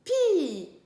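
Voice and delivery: "pi" loud and high, vowel stretched and falling; syllable sounded out. Shown as a demonstration. Teacher reading the second syllable with the class, loudly sounded out. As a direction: belt out "pi" with a long i. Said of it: "On peut lire".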